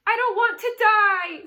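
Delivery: high, loud, wild scream. A narrator's reading. Pig screaming as he throws himself down. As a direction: fling the words out in panic. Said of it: "screamed Wilbur".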